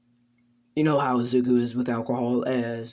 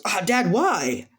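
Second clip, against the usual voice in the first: slapped protest; 6 semitones higher, slower than usual. Acted. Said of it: "he gets slapped".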